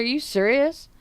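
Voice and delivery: disbelief. Retold or imitated. Driver shocked by offer of joint.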